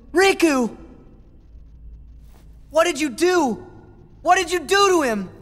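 high, rising, distraught questions; panicked cry. Acted. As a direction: demand answers in panic. Friend collapses, Sora panics.

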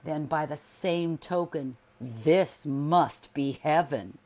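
loud and slow, mockingly triumphant; husband catching his wife out, with sarcastic triumph; smug sarcasm. A narrator's reading. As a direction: savor the trap like a professor. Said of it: "He smiled".

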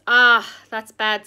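slow, loud and heavy beaver voice; gloomy Mister Beaver. A narrator's reading. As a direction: shake your head over bad news, slowly. Shaking his head.

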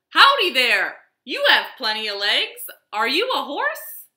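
high, loud friendly howdy; cheerful cowboy greeting. Acted. Cowboy greeting a wagon, high and loud. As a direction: call out like an eager young cowboy. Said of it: "said Cowboy".

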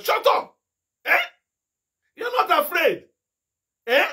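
sharp, forceful hissed rebuke of an evil spirit; preacher scolding the clicking thing; fierce rebuke. Retold or imitated. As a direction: drive the spirit back with a hiss.

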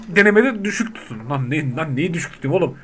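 ordinary talk

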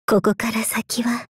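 right in ear. Acted. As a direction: lean in and whisper, just breath. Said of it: "悪魔が耳元でささやいて".

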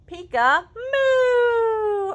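high, loud, stretched-out peek-a-moo; excited title announcement for toddlers; excited title reveal. Acted. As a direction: sing the title out like a surprise. Announcing book title to kids.

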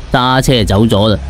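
usual speaking voice

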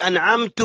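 أنعمت said "anʕamtu", ending in u; wrong ending. Retold or imitated. Teacher mimics prayer-voiding slip, u ending on أنعمت. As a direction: finish أنعمت on a u vowel. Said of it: "صلاة باطلة".

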